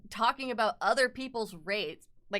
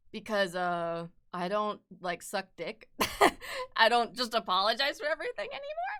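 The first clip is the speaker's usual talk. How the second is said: ordinary talk, nothing marked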